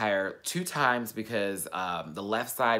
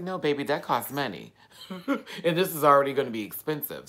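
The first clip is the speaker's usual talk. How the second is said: sassy, higher, half-laughing brush-off; 5 semitones higher, 5 dB louder than usual. Retold or imitated. Telling dad he won't come home, sassy and amused. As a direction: toss it off with sass and a giggle.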